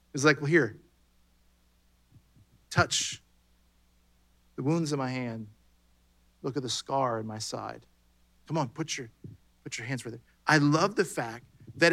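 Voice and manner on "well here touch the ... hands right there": gentle invitation. Acted. Jesus gently inviting Thomas.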